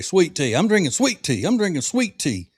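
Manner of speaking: lightly mock a Southerner making a point; a hint of a smug Southerner; mock show-off Southerner. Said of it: "Southerners that always have to make a point to say".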